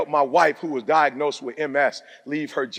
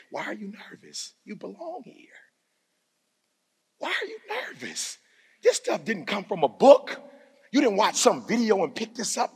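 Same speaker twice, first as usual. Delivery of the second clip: hushed self-talk; 5 semitones higher than usual. Retold or imitated. Retold self-talk on stage.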